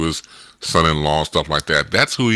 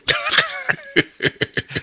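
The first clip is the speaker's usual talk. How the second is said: big real laugh. Real, not acted. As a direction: crack up at the joke. Friends joking about divorce.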